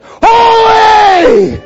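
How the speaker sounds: huge high-pitched drawn-out shout; thunderous angel cry. Acted. Angels calling out in the throne room, thunderous shout. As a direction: bellow it as if it shakes the building. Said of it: "it's a little more than that".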